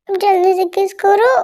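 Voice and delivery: coaxing. Acted. Slow playful demand.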